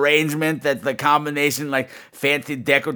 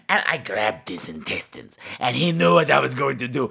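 ordinary talk, nothing marked

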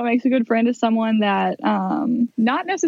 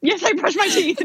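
high, mock-tearful protest; mortified reply; 9 semitones higher than usual. Patient answering the embarrassing brushing question, mock-tearful and high. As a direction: squeak it out as if about to cry.

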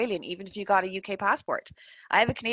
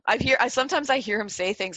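normal talking voice

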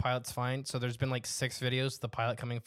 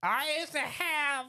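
high, slow put-on old man voice; drawn-out, high-pitched grandpa impression; tiny old man; 11 semitones higher, slower than usual. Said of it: "this little tiny old guy".

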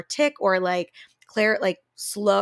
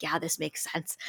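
quiet inner thought; 4 semitones lower, 9 dB quieter than usual. Retold or imitated. Inner thought while meeting patient.

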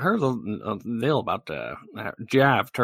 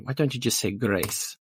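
ordinary delivery

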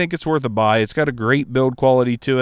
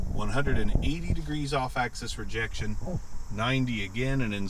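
off-mic; 10 dB quieter than usual. Shown as a demonstration.

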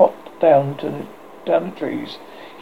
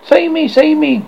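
high, louder cry for rescue; 13 semitones higher, 5 dB louder than usual. A narrator's reading. Nibs falls to the ground begging for rescue, crying out. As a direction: call out for help in a raised pitch. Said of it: "cried Nibs".

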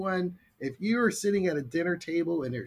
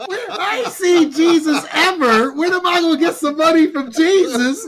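laughing protest; 12 semitones higher, 11 dB louder than usual. Retold or imitated. Granddaughter protesting to grandma, laughing high and loud. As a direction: whine through the laughter. Teen asking grandma for money.

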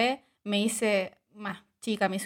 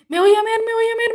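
high, loud desperate plea; hammed-up desperation, pitch way up; acted-out panic; 12 semitones higher, 6 dB louder than usual. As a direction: replay the urgent struggle to hold it. Acting out desperate urge on exam table.

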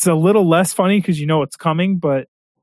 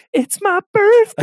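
Jeff Goldblum impression. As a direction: stretch out "birthday" in a high, lilting Goldblum voice. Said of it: "it's just Jeff Goldblum going".

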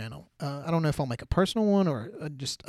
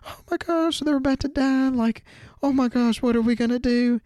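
much higher, louder frantic fretting; mock panic; 10 semitones higher, 4 dB louder than usual. Mimicking the panicking family members, high frantic voice. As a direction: squeal it like the family's sure they'll die. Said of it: "like, in sheer panic".

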